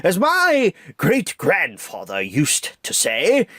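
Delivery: slow, pompous old owl, lightly voiced; stately old bird, slow; a hint of deep Owl. Reviewer showing the old Owl voice, slow and pompous. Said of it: "has always had a very deep voice".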